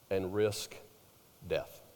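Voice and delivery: dropping to a low hush on death; quiet, grave emphasis. Real, not acted. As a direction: let it sink, soft and low. Preacher drops voice for gravity.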